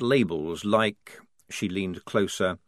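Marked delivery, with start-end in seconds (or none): none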